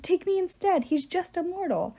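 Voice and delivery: high-pitched, pleading outburst; mild scream fading into pleading; desperate plea. A narrator's reading. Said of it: "she screamed".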